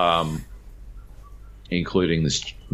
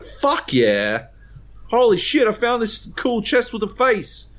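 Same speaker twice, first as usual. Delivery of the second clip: gleeful high yell of a lucky find; hammed-up squeal of delight over the chest; excited. Player telling crewmates about his find, high excited yell.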